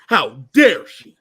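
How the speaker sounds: hammed-up slow indignation; mock outrage. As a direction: drag out How dare she, scandalised. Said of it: "And Daniel's like".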